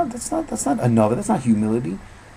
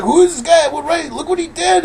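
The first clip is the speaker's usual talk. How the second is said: high, loud voice of a scandalized angel; incredulous angel; 8 dB louder than usual. Retold or imitated. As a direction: play the angel, appalled at the sinner. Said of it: "The angel's like".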